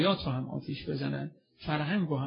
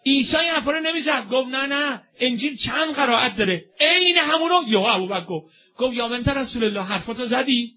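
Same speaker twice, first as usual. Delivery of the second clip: loud, high, fervent sermon storytelling; impassioned preaching; 9 semitones higher, 10 dB louder than usual. Acted. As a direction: preach the story at full force, pitched up. (روایت پرشور و بلند منبری)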